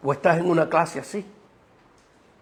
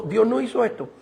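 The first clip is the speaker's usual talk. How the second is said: normal talking voice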